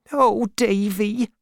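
high, drawn-out cry of affection. A narrator's reading. Peggotty calls to Davy through the door, crying out. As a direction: cry out slowly in a high woman's voice. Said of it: "cried Peggotty".

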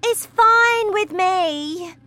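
mother not caring; careless mother. Acted. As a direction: shrug her off.

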